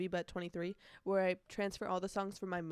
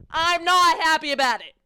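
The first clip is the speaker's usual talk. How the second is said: loud protest; 7 semitones higher, 14 dB louder, slower than usual. Real, not acted. Loud emphatic repeat.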